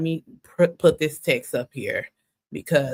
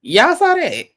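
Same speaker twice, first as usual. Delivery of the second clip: much higher, louder disbelief; incredulous; 12 semitones higher, 5 dB louder than usual. Real, not acted. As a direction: share your shock with the audience. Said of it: "reacting to the text".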